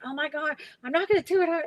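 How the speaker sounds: playful retold terror rising in pitch; mock panic. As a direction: act out the scared refusal, a bit hammed-up.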